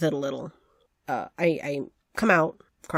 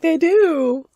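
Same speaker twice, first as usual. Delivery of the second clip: high mock-pitiful reply; 12 semitones higher, 6 dB louder than usual. Exaggerated reply.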